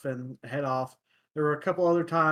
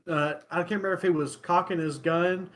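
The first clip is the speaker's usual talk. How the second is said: normal talking voice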